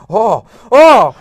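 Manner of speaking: loud, high overacted gasps; mock horror. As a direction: react like a hammy B-movie actor. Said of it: "he finds, like, a heart and he goes".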